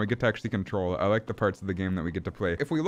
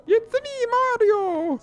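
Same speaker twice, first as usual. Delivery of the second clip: very high, far-from-natural Mario squeal; high-pitched Mario imitation; Mario catchphrase voice; 4 dB louder, much slower than usual. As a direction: do a loud falsetto cartoon Italian plumber.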